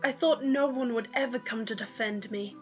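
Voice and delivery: ordinary delivery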